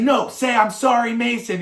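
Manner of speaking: loud, high scolding command; bossy big sister. Retold or imitated. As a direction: make him apologize, high and loud. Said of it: "and she's like".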